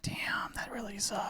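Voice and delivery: whispered, retold commiseration; hushed sympathy. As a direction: whisper it as if taking in bad news.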